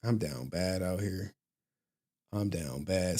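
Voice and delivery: low, soft mock confession; a hint of a glum, low mumbler; imitating a downbeat viewer. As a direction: lightly mimic a guy who is down bad. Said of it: "talking like this to me".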